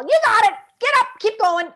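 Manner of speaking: high, loud, bright encouragement; cheering a toddler. Retold or imitated. Parent cheering a toddler who fell, high and loud.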